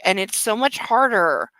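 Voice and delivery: ordinary talk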